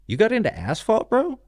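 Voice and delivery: retelling his shock at a friend's asphalt job; incredulous disbelief. Retold or imitated. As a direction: voice leaps up in shock. Retold shock at friend's paperwork.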